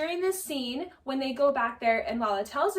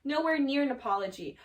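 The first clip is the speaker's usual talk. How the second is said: ordinary talk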